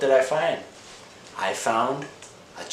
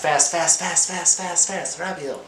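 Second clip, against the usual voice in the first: rapid-fire "fast", high-pitched run; 9 semitones higher, 3 dB louder than usual. Shown as a demonstration. Teacher showing how fast the pastor was speaking, fast. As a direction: rattle off FAST in a high, quick string.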